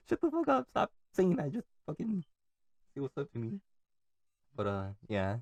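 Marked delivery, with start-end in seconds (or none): 0.1-3.6 much higher, mock exasperated snap; hammed-up high exasperated voice; annoyed friend. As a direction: play the fed-up friend, pitched way up. Voicing annoyed friend's retort.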